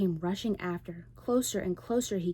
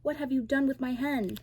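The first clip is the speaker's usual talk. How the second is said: loud, higher demanding yell; angry yell; 7 semitones higher, 5 dB louder than usual. A narrator's reading. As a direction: roar at the wife for the lost hen. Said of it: "he yelled".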